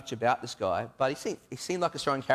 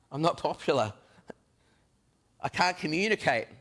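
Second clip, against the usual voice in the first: higher, pleading objection; anxious protest; 5 semitones higher than usual. Acted. Thomas pleading his flaws to Jesus, high and anxious. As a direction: plead your flaws, rising.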